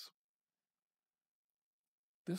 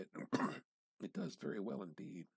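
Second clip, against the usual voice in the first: ordinary delivery, nothing marked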